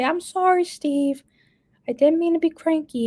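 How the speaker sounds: retold sugary apology to her husband; imitating herself. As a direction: babyish sorry, lightly played. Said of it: "I say".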